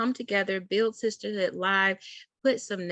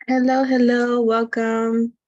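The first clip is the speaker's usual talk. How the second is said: loud, higher-pitched hello to new arrivals; cheerful greeting; 6 semitones higher, 10 dB louder than usual. Real, not acted. Host greeting viewers as they join the live, cheerful. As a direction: call out a big welcome, voice lifted and sustained.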